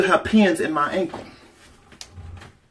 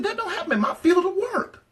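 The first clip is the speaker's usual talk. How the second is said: "That don't happen in my field of work" squealed very high with a laugh. Retold or imitated. Telling her friends what she said to herself, in a very high laughing squeal. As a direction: pitch it way up and giggle through "field of work". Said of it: "And I said".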